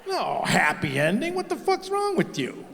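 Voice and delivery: high outraged squawk, slow on no happy ending; be scandalised, pitch flying up; shocked clerk's high outrage; indignant. Retold or imitated. Tire clerk offended by question.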